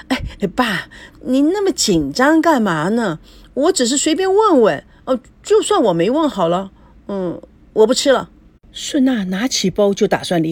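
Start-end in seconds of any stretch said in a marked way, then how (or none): 0.1-8.3 mildly flustered daughter backing off; guilty unease. A narrator's reading. Daughter stared down by her father, mildly guilty and defensive. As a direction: cover a slight nervousness with protest. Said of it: "心虚着说".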